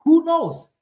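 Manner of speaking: high, rising shrug of a question; high-pitched who knows; rhetorical question. Real, not acted. Admits not knowing the future.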